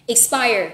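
"Expire" with a strong second syllable, ik-SPIRE; stress on second syllable. Shown as a demonstration. Teacher models the word for repetition, "Expire" stressed on SPIRE. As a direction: lean hard on the end syllable of "Expire". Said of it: "nhấn âm số hai ha".

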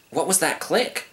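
curious cub; puzzled young lion. Acted. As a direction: wonder at an odd noise.

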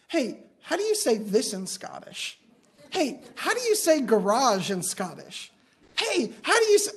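eager kid badgering a stranger; playful imitation of an insistent child; pestering little girl. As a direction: pester with bouncy, swooping pitch. Imitating second-grader pestering Scottish passenger.

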